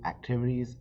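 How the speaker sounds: plain reading voice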